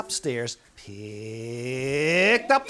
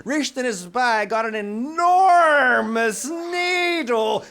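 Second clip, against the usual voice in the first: higher, louder, slow for the enormous needle; dramatic build; 9 semitones higher, 7 dB louder, slower than usual. A narrator's reading. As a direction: make it sound huge. Narrator builds up huge needle.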